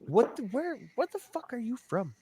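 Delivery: a high, soft, slightly put-on voice; Southern questioner. Locals asking where he's from, high and soft. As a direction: lightly mimic a puzzled local. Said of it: "It's like".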